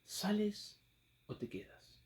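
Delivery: ¿Sales said softly, o te quedas? breathed in a whisper; ¿Sales o te quedas? hushed, almost a whisper; whispered question. Shown as a demonstration. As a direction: lean in and whisper the choice. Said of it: "Ese fue el subtema".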